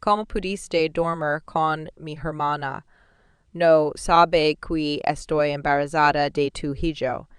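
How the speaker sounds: plain reading voice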